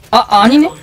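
normal talking voice

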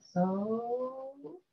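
So drawn out with a rising tune. Shown as a demonstration. Yoga teacher demonstrating the mantra, So rising upward. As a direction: let the word So climb upward. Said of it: "So intonation is going up".

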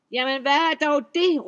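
high, loud alarmed troll shout; troll crying out. A narrator's reading. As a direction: call out in alarm at the fire. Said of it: "råbte trolden".

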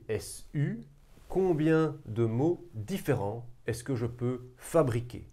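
usual speaking voice

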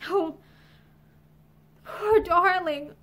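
slow, crying lament; tearful. A narrator's reading. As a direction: draw it out through tears. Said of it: "Violet's tears came again".

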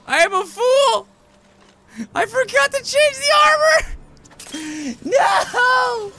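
building to a high, wailing mock scream; hammed-up despair. Realises wrong armor, hams up despair.

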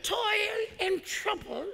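high, breathy witch cackle. Pretend. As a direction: play the old Disney witch. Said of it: "I don't know why I just did that voice".

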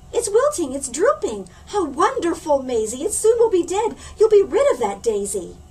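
high, loud delighted cheer with a laugh; high loud gleeful relief; gleeful excitement. Acted. Excited about the wilting daisy.

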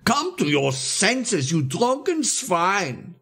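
high, drawn-out angry rebuke; forceful, high scolding; bellowing. A narrator's reading. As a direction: bellow it slowly, stretching each insult. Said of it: "bellowed with an extraordinary force".

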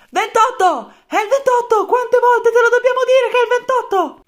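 high, furious yelling officer; furious shout. Acted. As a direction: scream it at the recruit. Colonel yells at forgetful soldier.

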